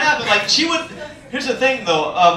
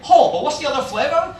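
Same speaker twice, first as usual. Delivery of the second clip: higher, questioning customer voice; mock customer question; clueless customer; 5 semitones higher than usual. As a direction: mimic a dim customer, pitched up. Imitates the customer.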